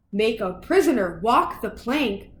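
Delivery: plain reading voice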